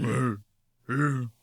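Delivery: short imitated bear growls. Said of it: "And they're going".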